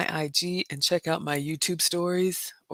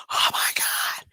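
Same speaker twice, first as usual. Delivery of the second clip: breathless horrified whisper; whispered oh my god. Retold or imitated. Reliving her scream at the gate, whispered in horror. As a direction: gasp it out in shock.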